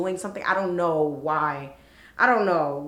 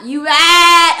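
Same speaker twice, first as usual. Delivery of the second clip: high, very loud drawn-out jeer; gleeful sing-song taunting yell; laughing taunt; 9 semitones higher, 15 dB louder than usual. Retold or imitated. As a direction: holler it mockingly through laughter. Acting out laughing taunt at woman.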